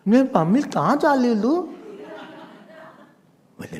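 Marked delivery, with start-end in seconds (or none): 0.1-1.7 put-on high, fast childish prattle; baby talk. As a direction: lisp it like a toddler calling for mummy. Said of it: "How the children".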